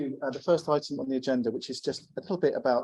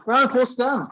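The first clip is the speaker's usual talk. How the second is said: higher and louder, rallying the room; calling for applause; 9 semitones higher, 7 dB louder than usual. Real, not acted. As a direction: rouse the crowd to clap for Dan. Host calls for applause.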